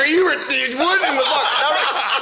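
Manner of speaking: high, laughing disbelief; incredulous laughing. Retold or imitated. Teen reacting to a punch in the helmet, laughing incredulously. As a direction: squeal it out through laughter, dragging the last words.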